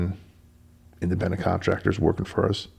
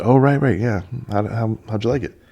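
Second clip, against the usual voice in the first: caught off guard; 4 semitones higher, 6 dB louder than usual. Retold or imitated.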